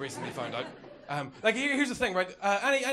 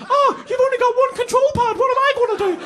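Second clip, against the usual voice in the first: much higher, louder whiny mock voice; mocking whiny friend; 12 dB louder than usual. To the friend, a much higher squeaky mocking whine. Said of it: "you were like, 'Oh".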